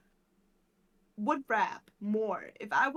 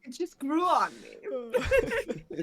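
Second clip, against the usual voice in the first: slow, higher, drawn out with a laugh; 4 semitones higher, 4 dB louder, much slower than usual. Real, not acted. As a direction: stretch the joke out through laughter. Joking about the accent, laughing.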